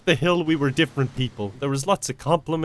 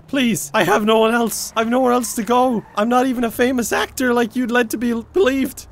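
higher, louder pleading; higher, louder begging to stay; desperate plea; 7 semitones higher, 4 dB louder than usual. Acted.

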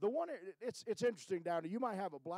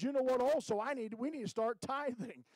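eager announcement; 6 semitones higher, 6 dB louder than usual. Retold or imitated. Husband's eager decision, retold.

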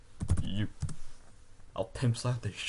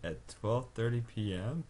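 ordinary talk

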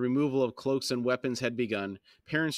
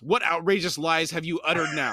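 higher, louder indignant question; glaring demand; 4 semitones higher, 5 dB louder than usual. A narrator's reading. Call confronts Krupp about his lies, indignant demand. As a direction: demand an answer in outrage. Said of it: "Call swung a glare on Krupp".

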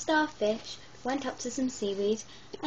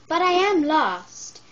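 louder, higher plea; 6 semitones higher, 8 dB louder than usual. Acted. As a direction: call it out, high and pleading. Starfish pleads for help.

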